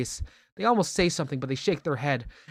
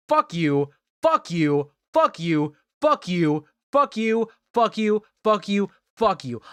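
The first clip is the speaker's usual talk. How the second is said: loud higher shouted curses; shouting; 4 semitones higher, 4 dB louder, slower than usual. Acted. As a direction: yell it over and over. Repeated curse, escalating.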